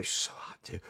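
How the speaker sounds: very soft, unvoiced whisper; whispered aside. Real, not acted. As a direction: drop to a whisper, confiding in the listeners. Confiding aside about the waitress.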